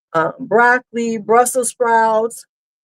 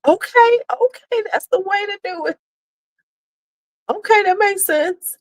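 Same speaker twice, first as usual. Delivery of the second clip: high laughing okay; 11 semitones higher than usual. Real, not acted. Reacting to chat.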